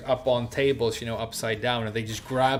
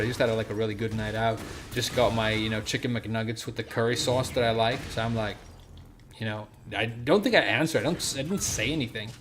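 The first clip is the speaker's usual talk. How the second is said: normal talking voice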